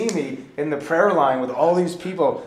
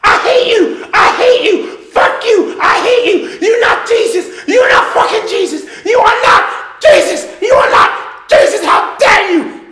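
full-throated furious screaming, high and loud; hoarse top-of-the-lungs yelling in a retold fight; screaming rage; 15 dB louder, slower than usual. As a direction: scream it at him with everything you have. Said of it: "You were just screaming".

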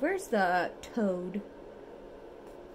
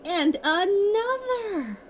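drawn-out, higher, louder squeal of delight; 10 semitones higher, 8 dB louder, slower than usual. Acted. Parent shows toddler the bunny page, delighted squeal. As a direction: stretch it out with delight. Delight over bunnies in book.

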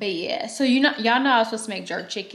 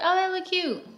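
put-on high girly friend voice; 10 semitones higher than usual. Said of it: "She was like".

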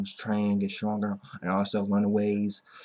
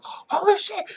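much higher, startled cry; shocked exclamation; slower than usual. Retold or imitated. Hero realizing the sale is today, shocked. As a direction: blurt it out in disbelief.

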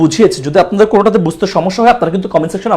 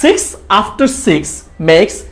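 very slow, word by word, each six with a clear s; slow tongue twister; much slower than usual. Shown as a demonstration. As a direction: spell out the tongue twister slowly. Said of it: "কি বললাম?". (ধীরে ধীরে tongue twister)

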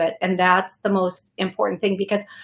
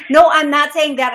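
higher, louder quick correction; emphatic correction; 6 semitones higher, 8 dB louder than usual. Real, not acted. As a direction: set her straight firmly. Correcting the caller.

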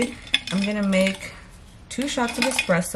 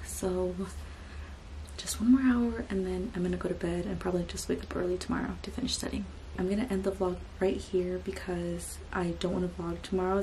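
soft late-night whisper; 9 dB quieter than usual. Real, not acted. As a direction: keep it hushed, house asleep. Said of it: "I have to whisper because everybody in my house is sleeping".

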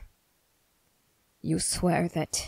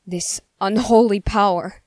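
loud, forceful denunciation; outraged condemnation; 3 semitones higher, 9 dB louder than usual. Acted. Accuser confronts a shapeshifter over unholy power, loud and outraged. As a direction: spit it out as an accusation.

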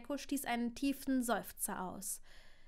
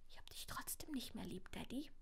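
put-on soft sulky child's whisper; 12 dB quieter than usual. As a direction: breathe it out, hurt and small. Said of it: "flüsterte Scorpius".

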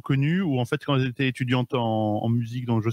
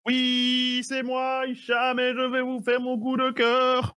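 pitched way up, playful; high playful voice; 10 semitones higher, slower than usual. Acted. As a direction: answer in a high silly voice. Playful high reply to announcement.

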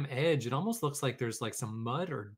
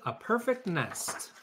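slow, high marvelling voice; delighted goose; 7 semitones higher, slower than usual. Acted. Goose admires the nest, slow high voice. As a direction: draw it out with wonder. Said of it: "she cried".

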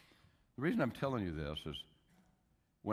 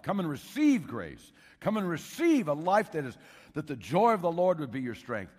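loud, high, slowed preaching cry; impassioned altar call; 6 semitones higher, 7 dB louder than usual. Retold or imitated. Preacher re-enacts inviting students to come forward, loud and impassioned. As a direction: plead with the hall to come forward.

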